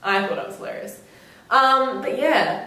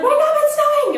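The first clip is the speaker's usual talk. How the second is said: high, fast, repeated calls to wake everyone; bursting with joy to get them up; excited wake-up call; 8 dB louder than usual. Retold or imitated. Waking the front room.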